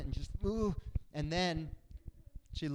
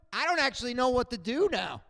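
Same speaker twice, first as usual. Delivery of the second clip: usual speaking voice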